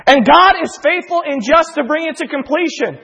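high, loud, climactic preaching; soaring loud sermon climax; preaching at full force. Acted. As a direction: lift the room, preach it out.

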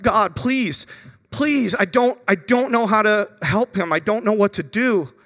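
loud, high-pitched pleading; desperate prayer. Retold or imitated. Father praying over his screaming baby, desperate pleading. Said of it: "So then I'm just praying to God".

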